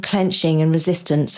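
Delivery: ordinary delivery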